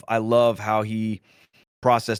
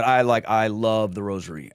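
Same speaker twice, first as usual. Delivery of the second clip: plainly heartfelt, no show; sincere. Real, not acted. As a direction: say it in earnest, pitched well below the gushing. Own sincere take after generic quote.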